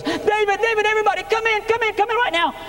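high, urgent calling out; sister's urgent cry at the door; frantic calling. Retold or imitated. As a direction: call them in, panicked and high. Sister bursts out calling them.

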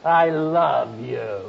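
"I love you." said slowly with weight on "I", then falling. Shown as a demonstration. Preacher shows a slow, falling I love you. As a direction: lean on "I" in "I love you." and let it fall slowly. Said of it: "just by the inflection of your voice".

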